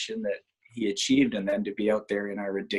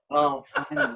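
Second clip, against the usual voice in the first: mock relief; 13 semitones higher than usual. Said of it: "And I just thought".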